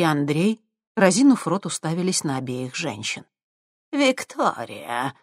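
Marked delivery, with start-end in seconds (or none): 3.9-5.1 haughty. Acted. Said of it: "высокомерно кивнула в ответ старуха".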